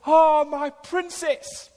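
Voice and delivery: high, slow doting coo; heart welling up. Retold or imitated. Father looks at baby Ivy, heart welling up. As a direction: coo it slowly to your baby, adoring. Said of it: "my heart wells up".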